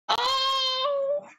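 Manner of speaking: high, wailing hammed-up crying; mock sobbing. Bullywugs sobbing over each other. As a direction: blubber it like weeping monsters. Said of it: "crying and holding each other".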